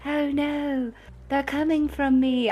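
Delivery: softly posed British "oh no"; British accent ASMR. Acted. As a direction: play danger far too gently in a British accent. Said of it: "do A S M R and the accent".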